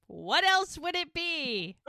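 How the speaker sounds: high, drawn-out exasperation; exasperated. Real, not acted. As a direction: stretch it out high, as if stating the obvious. Mocking the obvious answer.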